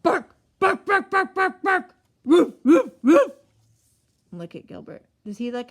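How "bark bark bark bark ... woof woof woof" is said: high, loud yappy barks; playful barking for kids; dog barking. A narrator's reading. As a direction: bark it out like an excited little dog. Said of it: "a dog barking".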